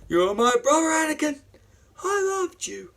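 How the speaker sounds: high-pitched anguished cry; loud plea easing off, still very high; acted anguish. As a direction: cry out in hammed-up heartbreak. Acts out Obi-Wan's anguished reply.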